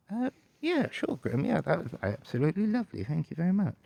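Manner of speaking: high-pitched, grateful acceptance; effusive guest. Pretend. Guest gratefully accepts butler's coffee.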